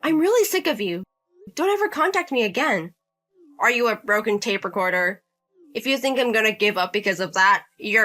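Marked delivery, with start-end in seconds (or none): 0.0-2.9 disgusted wife. Acted. Wife rejects husband's elder brother.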